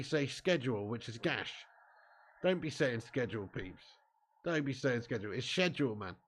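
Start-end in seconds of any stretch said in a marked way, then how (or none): none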